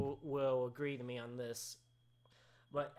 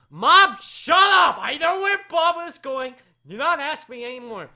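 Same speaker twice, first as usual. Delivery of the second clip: high, very loud yelling; angry shout; 9 semitones higher, 16 dB louder than usual. Real, not acted. Host fed up with being asked about Bubba, angry shout. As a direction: blow up and scream it.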